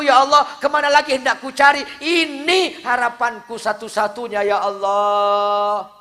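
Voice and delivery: high, loud lament to God; wailing prayer. Acted. Woman mourning the dead man, wailing high and loud. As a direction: cry out to God in grief.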